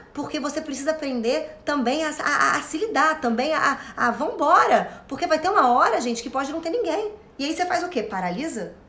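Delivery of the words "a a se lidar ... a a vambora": ordinary delivery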